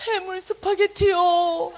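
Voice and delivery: put-on slow, high little boy voice; drawn-out child reply. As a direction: stretch out the dish name sweetly. Son answers, voiced drawn out.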